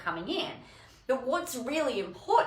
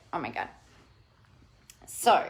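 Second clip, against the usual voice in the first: usual speaking voice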